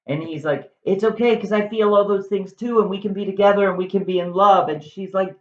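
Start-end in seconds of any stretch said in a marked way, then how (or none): 0.8-4.7 eager lover. Acted. Romeo declares his love.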